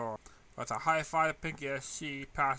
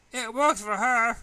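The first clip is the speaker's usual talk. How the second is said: defensive; 8 semitones higher, 11 dB louder, slower than usual. A narrator's reading. Defensive reply.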